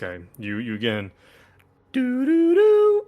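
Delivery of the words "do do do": high, drawn-out sung beeps; imitated phone tones. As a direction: sing out the phone's beeps, held and loud.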